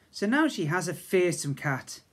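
ordinary delivery

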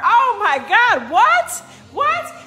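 high, loud astonished what; hammed-up shocked what; mock amazement. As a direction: gasp it out in delighted disbelief.

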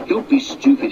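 driver's rebuke. Acted. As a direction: snap at the reckless engine to stop.

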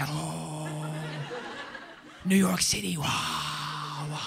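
put-on airy, drawn-out wonder in a German accent; mock-awed German admirer; imitated German awe. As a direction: swoon like a starstruck German. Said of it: "German people get excited about New York City".

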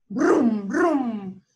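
loud rumbling brum of a truck; truck engine roar. A narrator's reading. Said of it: "Bramó el camión de carga". (rugido de camión)

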